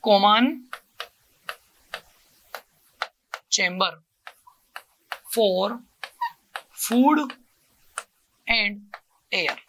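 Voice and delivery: one word at a time with long pauses between; word-by-word dictation. Shown as a demonstration. Teacher writing it on the board, dictating word by word. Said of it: "तो यार भी इसमें लिख दूं".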